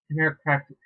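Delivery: ordinary delivery, nothing marked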